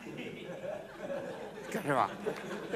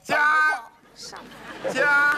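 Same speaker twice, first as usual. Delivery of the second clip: 大声喊将; 5 semitones higher, 4 dB louder than usual. Acted. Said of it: "你不要嘴里在喊".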